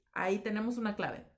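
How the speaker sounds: usual speaking voice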